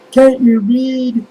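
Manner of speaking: high, loud mock shout; shrill imitation of a cop yelling at me; imitating a cop's indignant yell. Cops yelling at trespasser.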